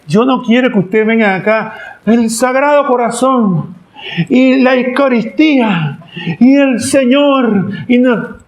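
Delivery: high, slow, solemn divine voice; voice of God. Acted. God telling his faithful what he wants, high and solemn. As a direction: speak as God, grave and drawn out.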